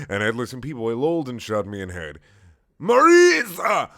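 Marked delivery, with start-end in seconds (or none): 2.8-3.9 high, drawn-out yell; screamed name. Acted. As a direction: scream the name at full volume. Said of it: "Wheatley screamed".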